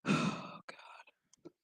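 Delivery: breathy unvoiced sigh of despair, very soft; whispered groan. Real, not acted. Speaker whispering to himself about the show, a soft groan. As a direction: let it out as a weary whisper. Exhausted aside after a sip.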